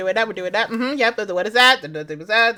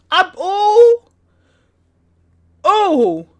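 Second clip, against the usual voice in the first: mock anguished cry; 7 semitones higher, 6 dB louder, much slower than usual. Real, not acted.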